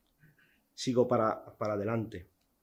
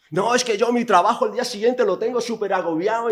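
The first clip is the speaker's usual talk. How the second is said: mock whiny excuse; 7 semitones higher, 4 dB louder than usual.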